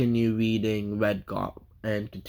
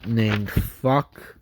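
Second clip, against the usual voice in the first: usual speaking voice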